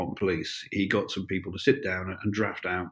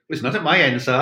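put-on higher, louder little Welsh accent; 5 semitones higher, 5 dB louder than usual. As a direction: pipe up in a small Welsh accent. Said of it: "this little Welsh voice went".

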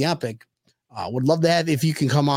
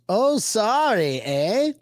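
slow, drawn-out Canadian apology; exaggerated Canadian "sorry, eh"; mock Canadian accent; 4 semitones higher, much slower than usual. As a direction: stretch it out in a hammed-up Canadian accent. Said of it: "Boston Chrissy goes".

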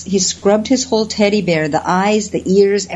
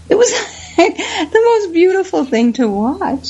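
real laugh breaking into the line, pitch jumping high; laughing fondly; 11 semitones higher than usual. Real, not acted. Telling the host about the monkey, laughing with joy. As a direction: laugh with delight at the memory.

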